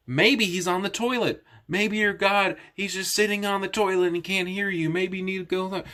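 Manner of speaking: mocking, pitched well up; Elijah. Elijah taunting the prophets about their god, mocking. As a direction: needle them with mock-helpful suggestions, high voice. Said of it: "he starts making fun of him".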